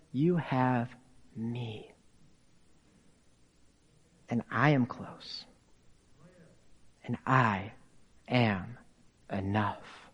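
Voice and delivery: very soft, low, with long pauses; tender reassurance. Retold or imitated. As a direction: let it sink to a hushed, intimate promise. God tenderly reassures Elijah.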